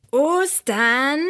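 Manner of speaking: stretched, rising query; disgusted disbelief. A narrator's reading. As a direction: echo it with puzzled distaste. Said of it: "Michi verzog das Gesicht".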